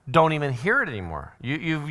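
ordinary talk, nothing marked

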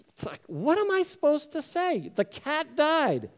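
high-pitched, exasperated protest. Acted. Brother called too blunt defends himself, exasperated. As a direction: throw it back at him, exasperated, dragging the last words. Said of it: "Another brother kind of exasperated goes".

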